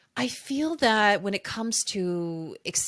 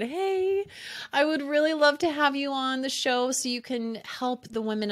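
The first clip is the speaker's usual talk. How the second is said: much higher, bright retold ask; high-pitched eager quote; 7 semitones higher than usual. Retold request to guest.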